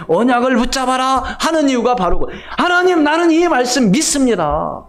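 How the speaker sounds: high-pitched, ringing pulpit voice; full-force preaching. Acted. Preacher urging the congregation to hold the covenant, full-force preaching. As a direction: proclaim it insistently, pitch held high.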